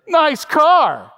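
exaggerated, loud, high-pitched sneer; hammed-up sarcastic compliment; mocking compliment. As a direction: mimic a snide "Nice car". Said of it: "I don't think he said it like that".

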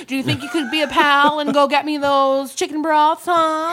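loud, hammed-up old radio announcer; dad's radio voice. Dad sending Billy for chicken broth, exaggerated radio voice. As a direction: boom it out like a folksy radio host. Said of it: "like, radio voice".

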